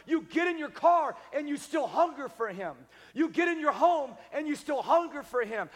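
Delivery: loud, high and driving, hammering each line; full-force preaching. Acted. Pastor urging the church to carry revival, full-force preaching.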